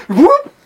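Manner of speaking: high, loud rising whoop; cartoon leap sound effect; quick whoop shooting upward; imitated jump noise. Imitates the boss jumping away.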